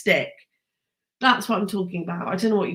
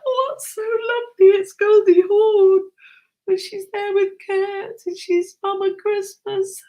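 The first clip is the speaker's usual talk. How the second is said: much higher, louder gushing delight; 11 semitones higher, 6 dB louder than usual. Retold or imitated. Retelling crying at Christmas Chronicles, high gushing delight. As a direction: swoon over the film ending. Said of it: "I was like".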